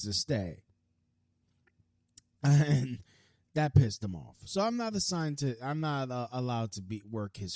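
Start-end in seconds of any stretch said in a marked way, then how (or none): none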